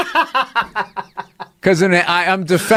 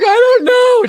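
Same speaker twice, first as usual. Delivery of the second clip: squeaky high, loud, helpless laugh-cry; words squeezed out through laughter; 5 dB louder, slower than usual. Real, not acted. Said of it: "Just crying, laughing".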